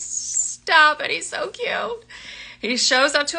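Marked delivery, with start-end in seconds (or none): 0.0-2.0 high-pitched, breathless gushing. Real, not acted. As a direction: swoon over him. Gushing over book love interest.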